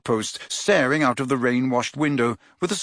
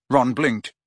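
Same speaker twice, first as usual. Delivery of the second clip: usual speaking voice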